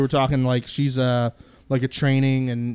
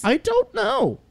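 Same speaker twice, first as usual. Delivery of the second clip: squeaky high coy voice, sharply raised; mock high voice; slower than usual. Podcast host feigns innocence about the photo, high squeak.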